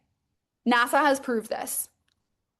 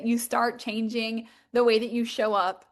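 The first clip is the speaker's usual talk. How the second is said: normal talking voice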